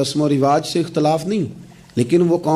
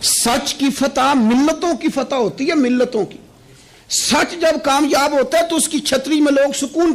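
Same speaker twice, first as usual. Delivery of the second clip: high, sustained proclamation; fervent preaching; 10 semitones higher than usual. Acted. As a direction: proclaim truth's victory in a high voice. Preacher proclaims triumph of truth.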